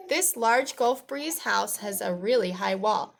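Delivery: put-on lively, projected read-aloud for children; storybook reading. A narrator's reading. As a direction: read it out clearly to young listeners.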